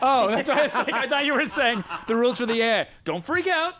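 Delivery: high, loud, laughing through words. Real, not acted. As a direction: laugh at the mix-up. Laughing at misunderstanding.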